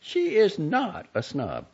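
Jenny insisting her friend is no snob; firm denial. A narrator's reading. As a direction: plant your feet on each word. Quarrel over the sister.

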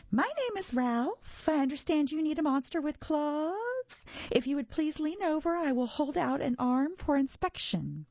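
a well-groomed monster showing off politely; high silky voice. A narrator's reading. Ralph offering his arm to the boy, high and silky. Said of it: "in a high, silky voice".

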